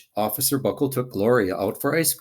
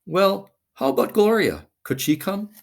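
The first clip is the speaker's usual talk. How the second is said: a touch of hopeful principal, higher on how about Gloria; lightly voiced principal; 9 semitones higher than usual. A narrator's reading. Missus Topple asks Officer Buckle whether Gloria could come, hopeful. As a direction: ask it hopefully. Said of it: "said Missus Topple".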